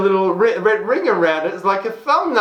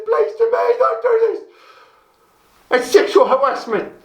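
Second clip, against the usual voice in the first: high-pitched British accent, hammed-up indignation; London accent; 8 semitones higher than usual. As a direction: put on a London accent, pitched way up and rising as you plead. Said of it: "have to have a British accent or a London accent".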